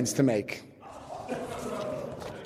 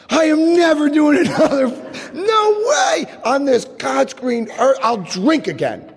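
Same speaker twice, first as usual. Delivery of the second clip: mock vow; 9 semitones higher than usual. Comic vow to himself after a bad night. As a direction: swear it off like a drunk's promise. Vowing never to drink again.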